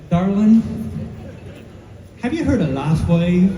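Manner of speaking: a friend's sweet Southern invitation; Southern accent. Retold or imitated. Friend calling with a Southern accent, asking about LifeWave. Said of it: "in her beautiful Southern accent".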